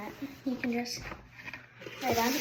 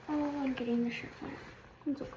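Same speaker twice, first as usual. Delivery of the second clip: usual speaking voice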